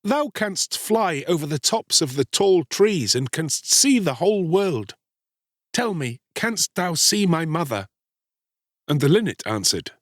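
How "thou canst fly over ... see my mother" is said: put-on higher-pitched searching boy; entreating Star-Child. A narrator's reading. Boy asking the linnet about his mother, entreating. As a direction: ask earnestly, voice lifted.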